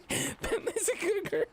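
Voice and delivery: sweet high praise for the dog; dog talk. Real, not acted. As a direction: coo at the dog.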